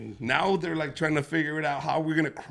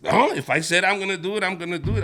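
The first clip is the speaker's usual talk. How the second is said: louder stubborn declaration; stubborn loud insistence; 5 semitones higher, 7 dB louder, faster than usual. Retold or imitated. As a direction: insist firmly.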